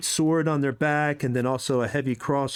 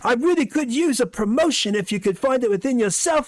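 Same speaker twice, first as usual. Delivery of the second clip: eager guard; 11 semitones higher, 4 dB louder than usual. Acted. Guard asks for promotion.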